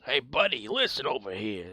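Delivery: tough Bronx fairy calling out; Bronx accent. Acted. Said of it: "Navi from the Bronx".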